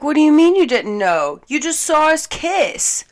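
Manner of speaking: imitating his high, loud disbelief; incredulous. Man insisting she saw them kiss, incredulous. Retold man's incredulous reply.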